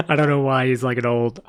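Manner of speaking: ordinary talk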